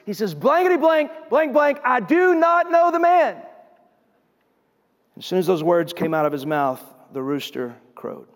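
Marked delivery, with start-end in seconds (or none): 0.3-3.4 high-pitched, exasperated acted outburst; censored swearing, then an emphatic denial; cursing denial. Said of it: "then Peter cusses. He curses".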